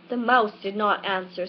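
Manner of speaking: ordinary delivery, nothing marked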